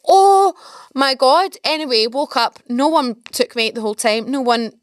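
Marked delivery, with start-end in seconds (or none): 0.1-1.6 high, loud gasp of dread; a hammed-up moment of fright; exaggerated horror; mock alarm. Told she sleeps closest to door.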